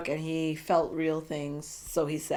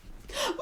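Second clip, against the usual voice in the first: gasp; 6 dB quieter than usual. Real, not acted. Swooning at his words.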